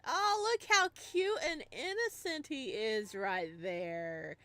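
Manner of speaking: sarcastic cooing. Acted. Sarcastic about the killer on TV.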